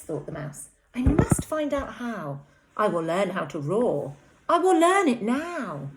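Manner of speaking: put-on lively read-aloud to young children; animated storytime voice; story narration. A narrator's reading. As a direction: read it like a bedtime story for little ones. Teacher reads picture book aloud.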